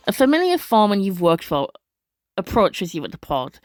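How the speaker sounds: ordinary talk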